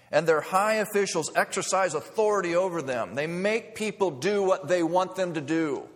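loud preacher's reread. Acted. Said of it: "That's what Jesus is saying".

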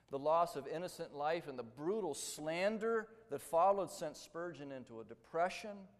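usual speaking voice

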